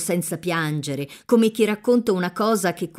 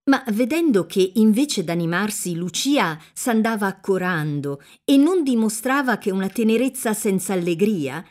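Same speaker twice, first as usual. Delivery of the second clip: usual speaking voice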